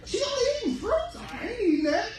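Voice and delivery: very high, incredulous squeal; squealing disbelief; mocking disbelief. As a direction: ridicule the fruitarian idea. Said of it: "I was like".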